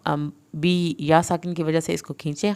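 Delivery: normal talking voice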